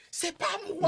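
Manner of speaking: high, pleading inner voice; inner plea. Retold or imitated. Son pleads innocence to mother, in a high panicked voice. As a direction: squeak it like a panicked kid.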